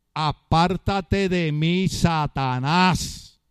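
very slow, drawn-out command; solemn rebuke. Retold or imitated. The Lord rebukes Peter, slow solemn command. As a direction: stretch each word out, landing low on "Satanás".

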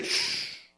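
long unvoiced shush. Moses to Joshua, who hears war cries, hushing. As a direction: hush someone sharply alarmed.

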